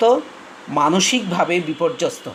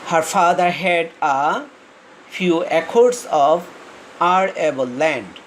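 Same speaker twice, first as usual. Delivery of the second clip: each word stretched out very slowly; slow reading aloud; much slower than usual. Shown as a demonstration. Teacher rereads the sentence for students, very slowly. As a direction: read it out word by word. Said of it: "slow dictation reading".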